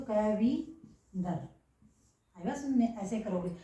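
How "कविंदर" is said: slow demo word. Shown as a demonstration. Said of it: "क में अगर वह को बड़ी ई बनाओगे".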